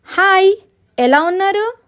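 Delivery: very high, lilting greeting; high storybook visitor; cheery ghost girl. Acted.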